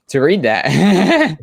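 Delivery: slow, rising into a loud laugh; drawn-out words tipping into a giggle. Real, not acted. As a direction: break into laughter as you finish. Laughs at own remark.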